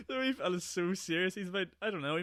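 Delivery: cracking up mid-sentence; real helpless laughter; laughing hard. Real, not acted.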